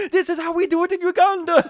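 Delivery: fast, loud, high yell; mock announcer yell, fast and loud; hammed-up hype shout. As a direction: roar it like an action-movie hype man. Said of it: "and then he goes".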